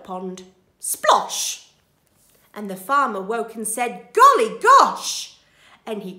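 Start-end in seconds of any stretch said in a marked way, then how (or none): 0.8-1.7 loud, high splosh; splash noise. A narrator's reading. Thieves stepping into the duck pond, loud splosh. 4.1-5.4 slow, high, loud surprise; startled exclamation. A narrator's reading. Farmer waking to find thieves, loud astonishment.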